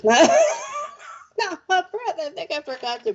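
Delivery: high, breathless laugh through the words; real laughing, out of breath. Real, not acted. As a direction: laugh it out, winded and giddy.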